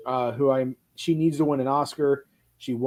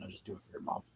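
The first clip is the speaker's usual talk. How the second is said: muttered aside; 19 dB quieter, faster than usual. Retold or imitated. Dad's quiet confession.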